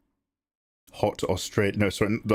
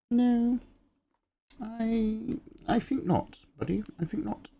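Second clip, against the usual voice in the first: very high silly voice; mock-prim high little protest; playful refusal. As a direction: refuse in a put-on high pitch. Playful refusal to a chat viewer.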